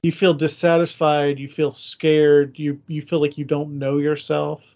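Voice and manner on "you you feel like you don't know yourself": ordinary delivery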